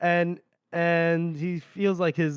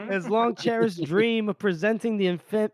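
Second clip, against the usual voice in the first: ordinary delivery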